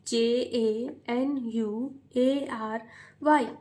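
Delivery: very slow letter by letter spelling; slow child reading. Shown as a demonstration. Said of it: "बच्चे पढ़ते हैं तो बहुत ही slow पढ़ते हैं".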